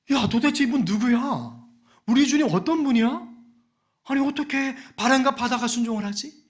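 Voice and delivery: startled, awestruck questioning; astonished disciples. Acted. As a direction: marvel aloud to each other in disbelief. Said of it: "깜짝 놀라는 것입니다".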